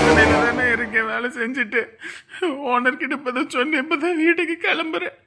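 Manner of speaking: sobbing, helpless phone voice; crying. Acted. Grieving caller explains being alone at the shop, crying. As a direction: talk on through tears. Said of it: "ஏன் அழுவுற?".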